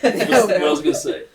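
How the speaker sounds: laughing. Real, not acted. Laughing at own forgetfulness.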